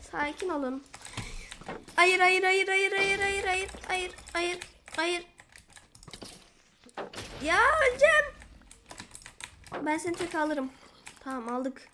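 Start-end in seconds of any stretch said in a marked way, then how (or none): 1.9-5.2 rapid, higher, louder pleading nos; panicked no. Real, not acted. Gamer panics under attack, rapid pleading nos. 7.4-8.2 high, loud wail of alarm; panicked cry. Real, not acted. Gamer about to die, high panicked wail.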